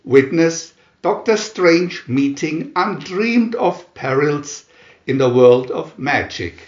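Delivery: ordinary delivery, nothing marked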